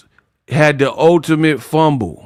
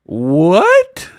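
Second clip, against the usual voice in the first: incredulous what; 4 semitones higher than usual. Real, not acted. Reacting to absurd quote.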